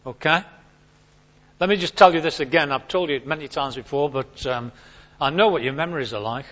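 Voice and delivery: ordinary talk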